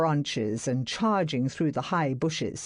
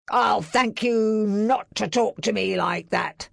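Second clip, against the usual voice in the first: louder, higher offended reply; cold anger; 6 semitones higher, 4 dB louder than usual. A narrator's reading. Barrett answering master, offended and angry. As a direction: put him in his place. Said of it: "Barrett replied".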